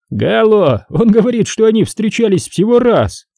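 high, needling cop voice; mocking detective, high voice; sly cop Ramos. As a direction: poke fun at the suspect, pitched high.

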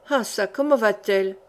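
plain reading voice

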